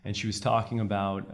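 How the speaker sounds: ordinary talk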